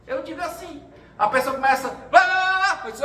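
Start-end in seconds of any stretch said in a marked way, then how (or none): 1.9-2.8 loud, high blah-blah babble; shouted nonsense chatter; mock ranting. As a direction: imitate someone going off on a rant. Mimics person ranting on phone.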